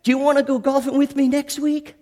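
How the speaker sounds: high, friendly invitation; rough guy inviting the preacher golfing, eager and high; eager golf buddy. Retold or imitated. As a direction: ask a new buddy along, upbeat. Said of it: "and he's like".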